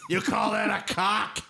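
Vince McMahon ghost. Pretend. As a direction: bellow like a ghostly wrestling promoter. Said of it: "You sound like Vince McMahon in the afterlife".

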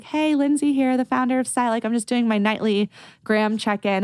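high, bubbly friendly hello; chirpy DM greeting. Retold or imitated. Founder messaging customers at night, high and bubbly. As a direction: greet a customer like a cheerful host. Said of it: "I'll be like".

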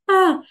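a laughing, mock-mortified cry; embarrassed squeal. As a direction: squeal at how bad it looked. Cringing at her old branding.

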